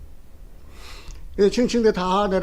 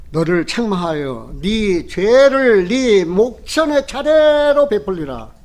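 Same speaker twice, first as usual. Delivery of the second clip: preacher booming out God's warning in Psalm 50; thundering scripture reading; 5 dB louder than usual. Acted. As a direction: read God's rebuke like thunder from the pulpit. Reads Psalm 50 as God's rebuke.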